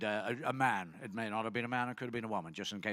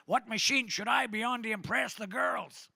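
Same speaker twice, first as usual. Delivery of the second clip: quoting a keen gym-goer in a higher voice; a pitched-up eager question; high eager guy; 8 semitones higher, 3 dB louder than usual. Retold or imitated. Comic to the crowd, a gym guy asking the trainer, higher.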